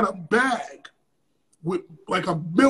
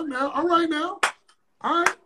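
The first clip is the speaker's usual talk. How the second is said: very slow, stretched, higher "all right"; drawn-out reluctant okay; 6 semitones higher, much slower than usual. Retold or imitated. Accepting the promoter's ten thousand dollars: slow, stretched and reluctant. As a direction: drag it out, unsure but taking the money.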